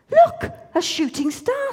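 excited shout at a shooting star. Acted. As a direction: point it out with wonder.

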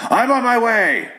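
high, drawn-out mock-heroic call; crime fighter. Cop hears the scream and answers, mock crime-fighter call. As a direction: announce it like a parody crime fighter. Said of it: "like a fucking crime fighter".

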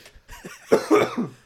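loud choking cough; coughing fit. Real, not acted. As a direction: cough from water down the wrong pipe. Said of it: "Water down the wrong pipe there?".

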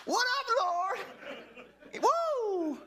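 high, bright mock greeting to the Lord; a playful high call to the Lord. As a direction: toss it up high and cheeky. Peter greeting Jesus playfully.

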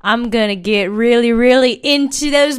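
loud, high, slow greedy gloat; mock greedy. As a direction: gloat over bags of money. Greedy joke about money.

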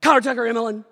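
high, loud parental roll call; frustrated calling out. Retold or imitated. Frustrated dad calls out his kids' names, loudly. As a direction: holler each kid's name in mock exasperation. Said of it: "You're frustrated, so you're calling everybody out".

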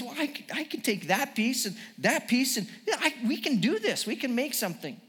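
high, lilting put-on English accent; mock-English tinkerer thinking aloud, high-pitched; Jesus. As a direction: piece it together eagerly in an English accent. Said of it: "an English accent".

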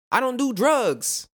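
high, loud, slowed-down wrong version. Shown as a demonstration. Host demos the wrong phrasing to listeners, drawn out high and loud. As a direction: say it like the self-talk to avoid.

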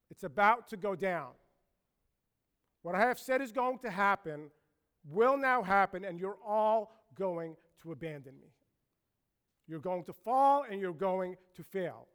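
loud, weighty pronouncement with pauses; forceful prediction in Jesus' words; solemn warning. Acted. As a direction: lay down a grave warning, phrase by phrase. Preacher voices Jesus warning disciples.

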